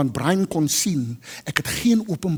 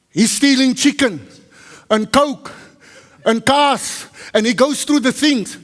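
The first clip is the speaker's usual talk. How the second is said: stern public warning; 7 semitones higher, 5 dB louder than usual. Retold or imitated. Boss warns the gathered staff about a thief, loud and stern. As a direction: call it out over the room to flush out the thief.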